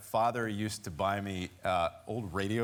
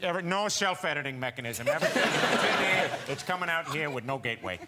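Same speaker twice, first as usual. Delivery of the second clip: character voice; 3 semitones higher, 3 dB louder than usual. Acted. Voicing a character on talk show.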